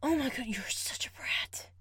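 breathy whisper, fond disbelief; whispered exasperation. Real, not acted. To the wriggling partner, an amused whisper. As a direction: whisper it half to yourself.

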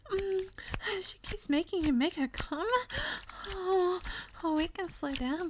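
high, soft and apologetic; timid, sweet-natured character. Acted.

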